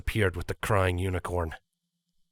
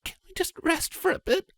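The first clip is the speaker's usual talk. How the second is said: high faint filly voice; weak pleading. Acted. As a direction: ask to rest, barely holding on. Injured filly begs to rest.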